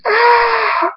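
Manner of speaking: high, sustained scream of pain. A narrator's reading. Hunter losing a wing, screaming. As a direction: shriek out the agony. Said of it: "Hunter screams".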